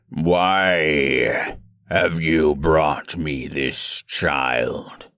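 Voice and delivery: very low, slow gurgling menace; deep gurgling monster. Acted. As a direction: drag each word up from the depths. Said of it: "in a deep, gurgling voice".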